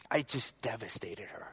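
breathy whisper of remorse; whispered confession; hushed whisper. Real, not acted. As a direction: drop to a whisper, ashamed. Remorse recalling hurting his sister.

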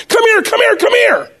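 high, urgent calling. Retold or imitated. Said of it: "she yelled her name out".

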